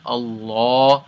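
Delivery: Allah with the double L built up and full A's; Allah with a long open A; heavy L Allah. Shown as a demonstration. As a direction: build up the L's in Allah. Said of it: "you're gonna build up".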